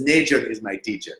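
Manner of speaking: a hint of a West African accent, like an old soda ad. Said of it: "I don't know how to do a African accent except for the guy from the seven up commercial".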